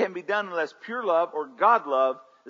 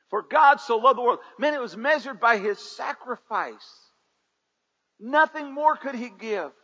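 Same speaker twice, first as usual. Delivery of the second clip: loud, high fervent preaching; 9 semitones higher, 8 dB louder than usual. Acted. Pastor preaching loudly to the church.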